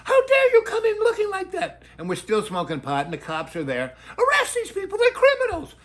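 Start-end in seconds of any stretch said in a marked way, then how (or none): 0.0-1.8 high-pitched outraged nun shriek. Retold or imitated. Nun shrieking at the half-naked mother. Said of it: "And she goes". 4.2-5.8 very high shrill nun demand; shrieking nun. Retold or imitated. Nun screeching at the cops to arrest them.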